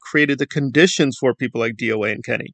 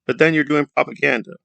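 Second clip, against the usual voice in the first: ordinary talk, nothing marked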